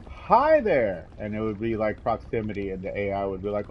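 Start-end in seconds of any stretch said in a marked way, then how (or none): 0.1-1.1 bright, loud friendly hello; cheery greeting. Retold or imitated. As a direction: greet an NPC eagerly. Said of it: "where I could be like".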